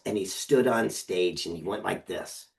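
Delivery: ordinary delivery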